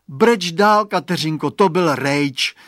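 put-on fed-up boy, high and loud on breč; disgusted little boy. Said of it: "řekl znechuceně Radovan".

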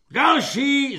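high, loud holler; bellowed order. A narrator's reading. Corporal calling the next recruit, bellowed. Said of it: "zahulákal svobodník".

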